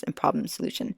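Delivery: ordinary delivery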